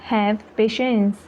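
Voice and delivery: slow, careful Have patience; slow repeat. Shown as a demonstration. Teacher repeating Have patience for learners, slowly. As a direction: spell it out slowly for beginners. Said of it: "teacher repeats sentence slower".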